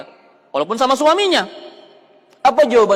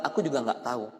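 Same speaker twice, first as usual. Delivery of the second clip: humble quiet answer; 7 semitones lower, 8 dB quieter than usual. Acted. Khalifah speaks humbly.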